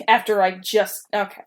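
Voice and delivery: ordinary talk, nothing marked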